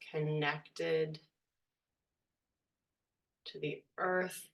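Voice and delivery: hushed, unhurried guiding voice; guided meditation. Real, not acted. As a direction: ease them gently into stillness, leaving space between phrases.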